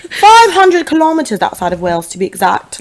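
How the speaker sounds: mock-precise British alter ego; put-on British, topping the fib; British accent. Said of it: "the kind of shit we would say".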